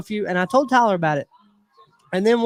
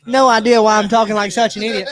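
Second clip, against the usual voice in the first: usual speaking voice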